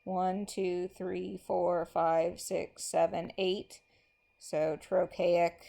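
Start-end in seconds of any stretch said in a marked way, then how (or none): none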